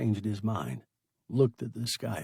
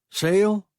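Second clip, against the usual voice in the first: loud call across the distance; 9 semitones higher, 10 dB louder than usual. A narrator's reading. Rescuers hailing the stranded man, calling out loudly. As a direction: call his name from the ship. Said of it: "they called".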